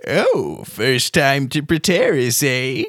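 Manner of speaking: put-on high, slow, creaky old gnome wizard, cheerfully cryptic. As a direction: play a quirky ancient gnome teasing a newcomer.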